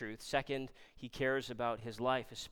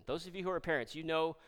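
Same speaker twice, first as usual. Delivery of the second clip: usual speaking voice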